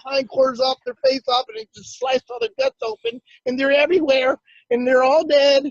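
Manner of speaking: high, distraught retelling of uncle's rant; yelling outrage. Retold or imitated. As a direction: rant over every slaughtered animal. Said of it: "he's yelling and screaming".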